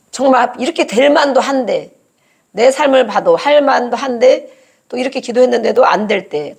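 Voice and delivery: ordinary talk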